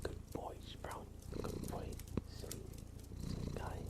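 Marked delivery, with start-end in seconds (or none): none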